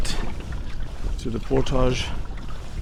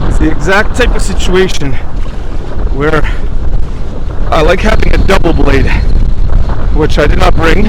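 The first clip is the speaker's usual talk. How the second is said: raised voice against the gusts; shouting over wind; 4 dB louder than usual. Real, not acted. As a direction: call out over a strong headwind. Talking into strong headwind.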